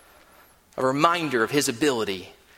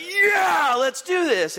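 fired-up shout; 13 semitones higher, much slower than usual. Retold or imitated. Absalom hyped up by Hushai's words, excited shout. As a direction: burst out ready for battle. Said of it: "He's like".

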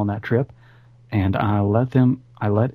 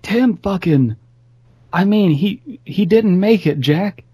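put-on drunken grief; 9 semitones higher than usual. Drunk grieving friend.

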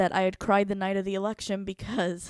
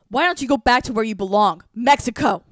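loud, higher, hostile shout; mock yell; 3 semitones higher, 12 dB louder than usual. Imitating classmate shouting a taunt at the speaker. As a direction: hurl the taunt like a furious classmate. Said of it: "she continues on".

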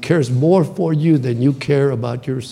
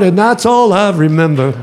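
ordinary talk, nothing marked

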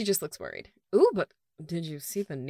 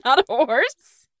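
laughing; 12 dB louder, slower than usual. Real, not acted. Laughing at a misread scene.

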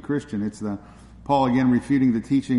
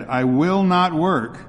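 slow, high, louder shouted refusal; crying out at top of voice; 5 semitones higher, 4 dB louder, much slower than usual. Acted. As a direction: stretch it out and shout it higher. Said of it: "cried Joe at the top of his voice".

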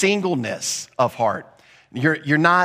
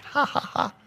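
hushed laugh; 10 semitones higher, 6 dB quieter than usual. Retold or imitated. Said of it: "She starts laughing".

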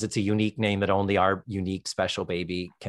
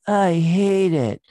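put-on baby voice; 7 semitones higher, 5 dB louder, much slower than usual. Baby voice in retold scene.

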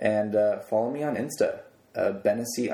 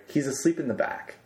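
usual speaking voice

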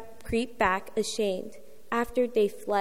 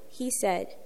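normal talking voice

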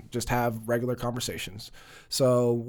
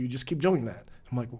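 ordinary talk, nothing marked

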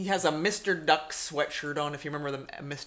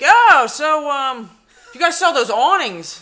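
odd customer; 6 semitones higher, 11 dB louder, slower than usual. Retold or imitated. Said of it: "and he's like".

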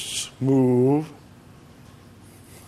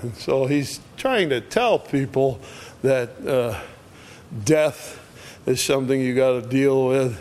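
ordinary talk, nothing marked

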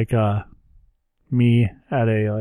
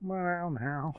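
Kermit voice; 7 semitones higher, 14 dB quieter than usual. Shown as a demonstration. Still in Kermit voice.

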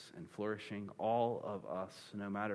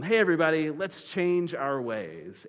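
mock rallying call; 7 semitones higher, 10 dB louder than usual. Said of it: "they're like".

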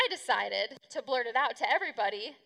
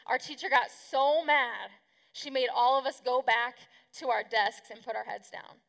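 ordinary talk, nothing marked

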